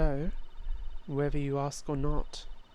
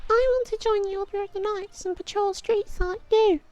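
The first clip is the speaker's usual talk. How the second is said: put-on very high, bright child's plea; eager little boy; 5 dB louder than usual. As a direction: squeak it up like a kid begging the captain. Child asks captain to join.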